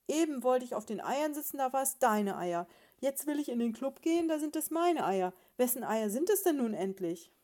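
loud, high-pitched exasperated tirade; shouting. A narrator's reading. Husband demanding whose eggs they are, shouting. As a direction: build an indignant rant at full voice. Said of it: "schrie er".

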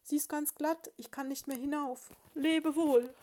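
high mouse cry from the pit; piepsende Verzweiflung. A narrator's reading. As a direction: squeak up in distress, loud on the farewell. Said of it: "piepste es gedämpft herauf". (hohes, verzweifeltes Piepsen aus der Grube)

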